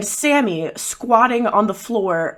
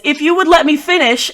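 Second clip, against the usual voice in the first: raised, louder indignant voice; exasperated insistence; 8 semitones higher, 5 dB louder than usual. Acted. Climber protesting to those below, high and exasperated. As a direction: insist over the interruption, pitched up. [name] objects to being interrupted.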